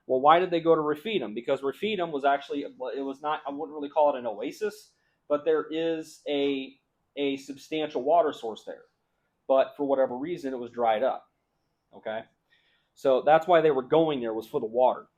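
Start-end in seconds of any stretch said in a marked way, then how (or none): none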